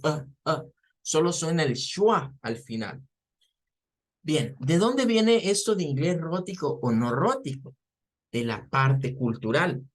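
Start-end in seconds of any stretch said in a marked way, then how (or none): none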